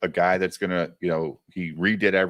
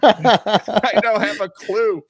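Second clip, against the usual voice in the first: high, loud, helpless whine; mock clueless self; 11 semitones higher, 6 dB louder than usual. Retelling his own confusion to friends, high and whiny. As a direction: play yourself totally lost and panicking. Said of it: "I was like".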